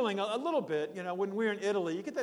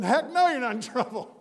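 loud, higher, laughing reassurance; hearty reassurance; 6 semitones higher, 8 dB louder than usual. Retold or imitated. Pastor reassures the nervous stranger, loud and hearty. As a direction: boom it out to put them at ease. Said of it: "I said".

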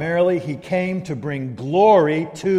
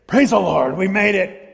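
mock triumph; 3 semitones higher, 4 dB louder than usual. Mock celebration of human self-improvement.

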